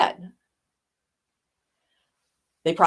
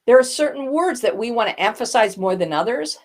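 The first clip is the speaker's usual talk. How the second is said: ordinary delivery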